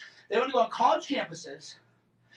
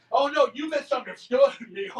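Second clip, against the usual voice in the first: a hint of a smug politician; lightly voiced smug dodge, half laughing; smug mock voice; 4 dB louder than usual. Mocking a congressman backpedalling in a lightly smug voice. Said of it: "He had that, like, smug affect".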